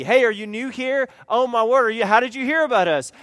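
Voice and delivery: loud, fast overeager welcome; gushing greeter. Retold or imitated. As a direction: smother the newcomer with cheer.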